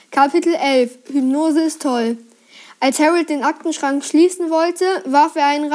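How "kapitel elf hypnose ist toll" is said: usual speaking voice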